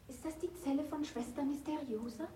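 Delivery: hushed, unvoiced whisper. Intruder searching the dark cell, whispering. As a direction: breathe the question into the dark.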